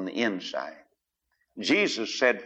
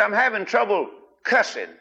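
mock confessor; 9 semitones higher than usual. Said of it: "you'd say".

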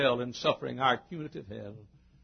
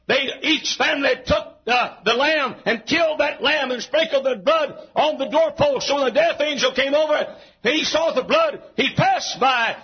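high, chant-like pulpit phrases; full-force preaching; 13 semitones higher, 10 dB louder than usual. Real, not acted. Preacher listing types of the cross, full-force preaching. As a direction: punch out each phrase on a high pitch.

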